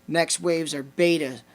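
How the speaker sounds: ordinary talk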